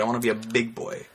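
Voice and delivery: ordinary talk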